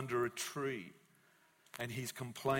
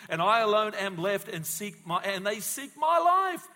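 loud, rising preacher's reading; preaching scripture; 6 semitones higher, 9 dB louder than usual. Acted. Preacher reads Romans to the congregation, building louder. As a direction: build the verse up to a cry.

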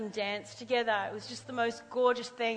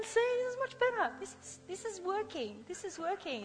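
the Lord encouraging; 6 semitones higher, 4 dB quieter than usual. Retold or imitated. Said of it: "He's going".

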